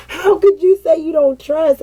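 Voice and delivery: high, hurt protest; retold hurt disbelief. Mother retelling argument with her son, high and hurt. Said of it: "it just hurt my feelings and I started crying".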